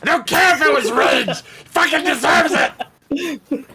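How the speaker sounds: high, heated yell; righteous shout. Acted. Player cheering the kill as deserved, righteous shout. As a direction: holler it in vindication.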